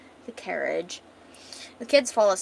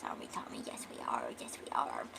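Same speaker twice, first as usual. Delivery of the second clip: cooing aside; 12 dB quieter, faster than usual. Real, not acted. Narrator breaks off to fuss over Tommy, cooing quietly. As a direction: coo it very softly, almost in a whisper.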